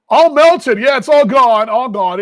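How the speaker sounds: excited. Real, not acted. Excited about snow gone.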